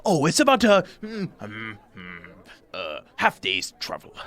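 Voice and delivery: lightly voiced nervous host, fussing and apologetic; a flustered host invites the strangers in; fussy little host. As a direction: ramble politely, tripping over words. Bock on Scarecrow.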